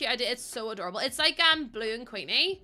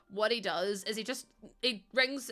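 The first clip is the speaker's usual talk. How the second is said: ordinary delivery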